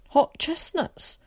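high, slow excited cry; high-pitched yell at no great volume; delighted yell. A narrator's reading. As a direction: cry it out high, stretching the words. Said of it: "yelled Willie".

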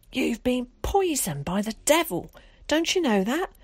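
high, horrified cry from the mother; frightened scream. A narrator's reading. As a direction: cry out in scandalised terror, pitch leaping. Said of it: "let out a frightened scream".

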